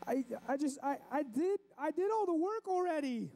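put-on high-pitched upset child voice; crying kid. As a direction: whine it out in a hammed-up kid's wail. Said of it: "and he's crying".

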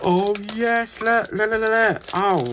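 thrilled discovery, bursting out; excited gasp. Real, not acted. Crafter spotting the crystals while unboxing for viewers, excited.

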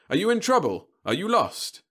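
high, loud cheery reassurance; robot salesman voice. Acted. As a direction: soothe by rote like a machine. Robot service feature.

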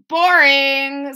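Lily mocking the plain toast; scornful girl. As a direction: brush it off. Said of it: "said Lily".

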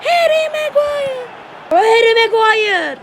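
long, loud, rising call of the name; drawn-out shout. Real, not acted. Gamer shouting at his player on screen, long and loud. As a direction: stretch the name out like a stadium cry.

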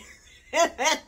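short loud laugh; real laugh. Real, not acted. Mom laughing about other moms' planners.

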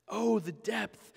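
hushed awe. Acted. Quiet awe after explaining.

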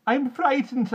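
put-on high loud childlike wail. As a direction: wail it like a scared little girl. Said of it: "I wailed".

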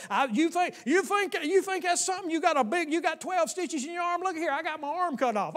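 high, loud mocking brag; boastful one-upping. A bragger one-ups someone in conversation, high loud mocking boast. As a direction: top the other guy's story, scoffing. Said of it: "people keep saying".

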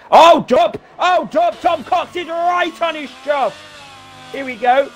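high excited shout. Real, not acted. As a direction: yell it out, thrilled. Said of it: "I'm getting excited now".

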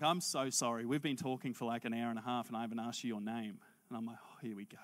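low, soft aside; understated retelling. Retold or imitated. Seatmate apologising for not asking his name, low and understated. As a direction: drop pitch and volume, almost to yourself.

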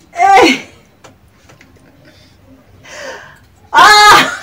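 high startled shout. Real, not acted. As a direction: yell out suddenly, high. Sudden shout.

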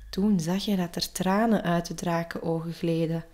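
usual speaking voice

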